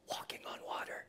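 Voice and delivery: soft whisper; hushed wonder. Shown as a demonstration. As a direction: whisper it in awe. Awed reveal in the story.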